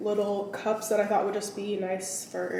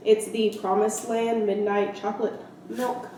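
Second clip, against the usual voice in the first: usual speaking voice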